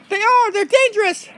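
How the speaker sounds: high, urgent dog voice; Oliver. Pretend. Oliver answers his captain about bugs, high and urgent.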